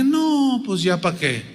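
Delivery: high, soft, drawn-out "no way"; dismissive disbelief. Acted. Old Sara doubts she can have a child, acted dismissive disbelief. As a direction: wave it off in a small, doubtful voice. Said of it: "ya así como que".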